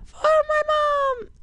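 put-on very high little boy voice; squeaky kid voice. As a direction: pipe it up like a small boy. [name] answers in kid voice.

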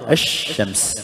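«الشمس» as ash-shams, the sh held long; assimilated lam. Shown as a demonstration. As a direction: drop the l of «الشمس» and lengthen the sh. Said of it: "مدغمة". («الشمس» بإدغام اللام في الشين)

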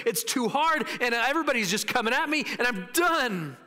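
high, exasperated complaint; acted giving up. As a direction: play someone worn out and quitting. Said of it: "he decided to give it up".

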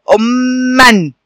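أمًا drawn out very slowly, doubled م held, ending -an; teacher stretches أمًا very slowly; slow model word. Shown as a demonstration. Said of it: "اسمها إيه يا أصدقائي". (نطق أمًا ببطء شديد مع شد الميم)

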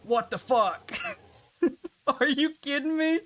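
high, stunned laughing outburst; can't believe what just happened; shocked disbelief. Real, not acted. Gamer reacting to a sudden death, laughing in shocked disbelief. Shocked after dying in game.

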